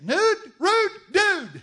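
high drawn-out shouted chant; call-and-response shout. Shown as a demonstration. Preacher leads the crowd in a loud chant. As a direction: belt it back to the crowd slowly. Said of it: "Say it".

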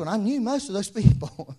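usual speaking voice

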